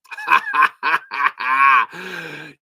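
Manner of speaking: loud, long laugh; delighted laugh. Real, not acted. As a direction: burst into a long, happy cackle. Delighted after listener's news.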